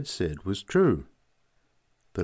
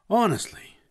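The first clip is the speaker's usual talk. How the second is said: high, loud burst of exasperation; exasperated cry; 13 semitones higher, 5 dB louder than usual. A narrator's reading. Woman fed up with Newt's question, crying out. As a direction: cry it out, pitched right up. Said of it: "she cried".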